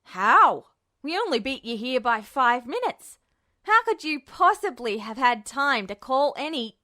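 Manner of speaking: loud disbelieving burst; startled exclamation. A narrator's reading. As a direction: blurt it out in shock. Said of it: "let out a startled exclamation".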